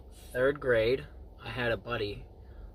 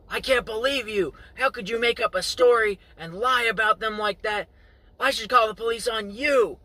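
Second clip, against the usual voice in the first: higher, louder mock yelling at the neighbor; mom yelling; 10 semitones higher, 7 dB louder than usual. Friend's mom yelling at the old lady to defend the boys. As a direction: defend the boys, tearing into her. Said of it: "starts yelling at this old lady".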